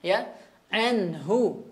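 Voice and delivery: very slow anhu with the nun drawn out; tajwid demo. Shown as a demonstration. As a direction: stretch anhu slowly, breathe the n. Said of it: "huruf nunnya". (anhu pelan, nun dialirkan)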